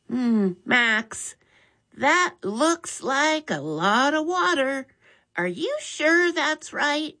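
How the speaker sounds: put-on young boy questioning his brother slowly; Mitch's boy voice. A narrator's reading. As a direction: wonder aloud like a cautious younger brother. Mitch doubts the water.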